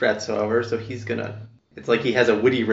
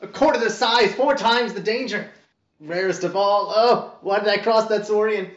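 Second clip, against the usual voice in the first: high, excited bird chatter; rambling bird voice; 10 semitones higher, 3 dB louder than usual. Acted. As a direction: babble it out like a bird. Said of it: "he starts rambling".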